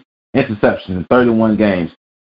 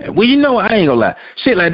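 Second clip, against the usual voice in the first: heated rant; 10 semitones higher, faster than usual. Real, not acted. Ranting, getting heated.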